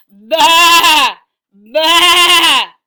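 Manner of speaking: loud, high, drawn-out baa; sheep bleating. Acted. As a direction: bleat at full volume like a sheep. Animal noises in read story.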